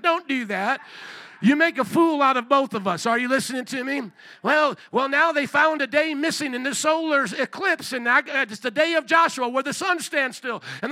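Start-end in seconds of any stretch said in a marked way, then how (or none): 4.4-10.6 higher, louder over-eager mimicry; mock excited discovery. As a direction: tumble out the big news, mock-amazed. Mimics gullible conspiracy sharer.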